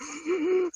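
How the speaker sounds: high mock tearful gasp; imitated crying gasp. Host showing how the movie got him, high mock gasp. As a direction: gasp in imitation of being overcome. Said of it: "dude, I was like".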